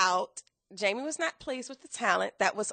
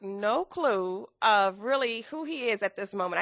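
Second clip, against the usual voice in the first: ordinary talk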